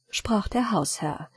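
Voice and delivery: ordinary delivery, nothing marked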